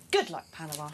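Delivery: low, menacing send-off; ominous villain warning; ominous Bond villain. Real, not acted. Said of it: "That sounded so ominous".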